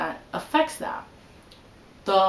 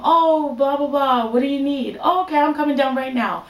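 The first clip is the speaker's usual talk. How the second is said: higher, louder mock willing husband; brisk, raised-pitch imitation of an obliging grandson; imitated eager reply; 5 semitones higher, 5 dB louder than usual. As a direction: lightly act out dropping everything to answer. Husband eagerly answering his grandmother.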